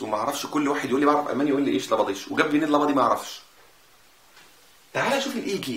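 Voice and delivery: ordinary delivery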